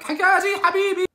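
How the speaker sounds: very high, loud excited yell; fanboy shout. Retold or imitated. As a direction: scream his name like a starstruck fan. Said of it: "obviously I'm like a fanboy".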